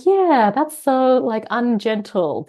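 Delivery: high, loud, drawn-out "yeah"; retold pointed agreement. Retelling the reaction to mum's accent change, emphatically agreeing. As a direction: exaggerate the reaction, slow and emphatic.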